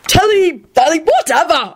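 high-pitched, breezy dismissal; unrepentant. A narrator's reading. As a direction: wave the correction away. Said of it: "Arthur said unrepentantly".

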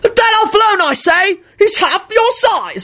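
high, indignant wizard bellow; high-pitched blustering protest, slowing at the end; bellowing stout wizard. A narrator's reading. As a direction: bellow in outrage at a bully. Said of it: "bellowed a stout wizard".

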